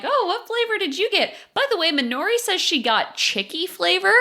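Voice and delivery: put-on high, bubbly girlish voice; high Manatsu voice. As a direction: squeak it out like an excited cartoon girl. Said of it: "Manatsu's like".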